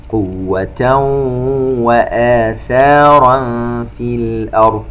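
slow chanted tajweed recitation with echoing qāf; Quran recitation. Shown as a demonstration. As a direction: recite slowly, bouncing the qāf. (تلاوة مرتلة ببطء مع قلقلة القاف)

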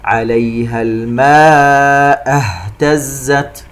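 slowly chanted, with a clearly sounded h in "اهتزت"; clear haa. Shown as a demonstration. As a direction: draw out "الماء", articulate the h of "اهتزت". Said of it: "فلا بد من توضيحها". (ترتيل بطيء مع توضيح هاء "اهتزت")

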